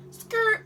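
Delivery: high tyre-skid imitation; high screeching car noise. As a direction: make a car screeching sound. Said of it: "a car goes".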